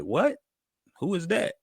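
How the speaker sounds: high, questioning pitch, acting out confusion; puzzled high-pitched reenactment; retold bafflement. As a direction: replay being totally clueless, voice up high. His puzzled reply being retold.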